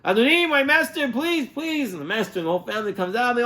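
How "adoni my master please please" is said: high, pleading servant stuck in the pit; hammed-up high-pitched pleading; mock plea from pit. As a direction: beg up from the pit. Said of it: "So he screams".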